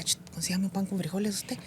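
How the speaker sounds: hushed, conspiratorial aside; furtive request. Retold or imitated. Coworker secretly asking for banned bread rolls, furtive and hushed. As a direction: slip the order in under your breath.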